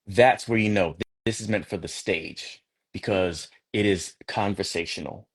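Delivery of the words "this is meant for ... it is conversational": usual speaking voice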